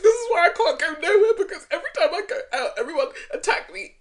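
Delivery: high, loud, hammed-up wailing; mock crying complaint. Host imitating a cast member feeling attacked, mock tearful wail. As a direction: wail it with a laugh underneath. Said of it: "Why are you crying?".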